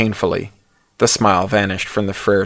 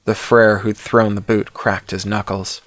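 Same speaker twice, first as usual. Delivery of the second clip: ordinary delivery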